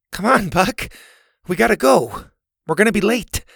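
high-pitched, pleading urging; urgent coaxing. A narrator's reading. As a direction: coax a stubborn dog to move. Boy urges his dog along.

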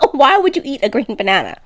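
ordinary talk, nothing marked

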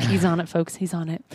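low murmured aside; quiet aside. Real, not acted. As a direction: drop it to a murmur for the listeners. Aside to audience.

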